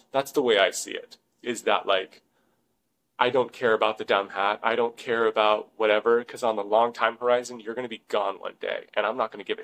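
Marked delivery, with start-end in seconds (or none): none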